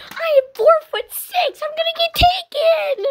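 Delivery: high, loud tearful complaint; shrieking, half-crying protest; panicked wail. Real, not acted. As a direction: wail it as if truly in danger. Frightened protest at being sent alone.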